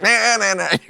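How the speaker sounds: high, loose sung-out horn noise; mock talk-show band sting; imitated band fanfare. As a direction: mimic a show band with nasal syllables.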